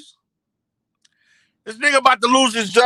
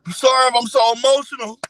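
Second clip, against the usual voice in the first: mock crying. Streamer imitating a man about to be fired, mock crying. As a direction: apologise as if overcome, badly faked. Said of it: "did you see me crying?".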